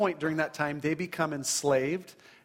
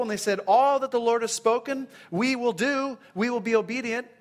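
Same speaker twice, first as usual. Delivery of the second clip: raised, vivid Bible reading; scripture reading; 4 semitones higher, 3 dB louder than usual. Acted. Preacher reading the covenant ceremony, raised and vivid. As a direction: read the blood ceremony with rising intensity. Reads Exodus twenty-four aloud.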